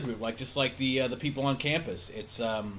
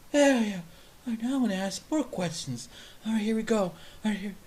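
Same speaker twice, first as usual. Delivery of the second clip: higher excited pep talk; higher excited self-talk before the next question; eager attendee; 8 semitones higher than usual. Retold or imitated. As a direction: hype yourself up again. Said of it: "that's him trying to assert himself".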